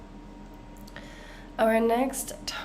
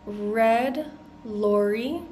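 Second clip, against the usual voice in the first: slow practice; much slower than usual. Shown as a demonstration. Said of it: "Let's practice this one together".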